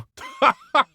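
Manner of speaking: short, high burst of laughter. Acted. Laughs at the meet-up idea.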